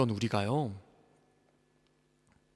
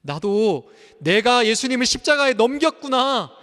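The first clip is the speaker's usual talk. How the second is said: louder, higher summary points; forceful preaching; 6 semitones higher, 8 dB louder than usual. Acted. Pastor summarizing the sermon, forceful. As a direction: proclaim each point firmly.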